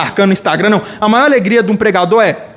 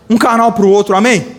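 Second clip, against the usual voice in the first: ordinary talk, nothing marked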